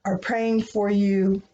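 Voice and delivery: usual speaking voice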